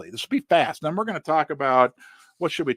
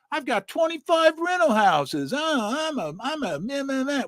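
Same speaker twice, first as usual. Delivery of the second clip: smug rich landlord; 7 semitones higher than usual. Retold or imitated.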